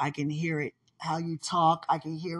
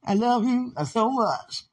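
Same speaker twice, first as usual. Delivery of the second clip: gushing love; 6 semitones higher, slower than usual. Real, not acted.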